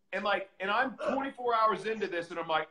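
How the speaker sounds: normal talking voice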